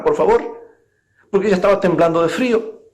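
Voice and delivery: usual speaking voice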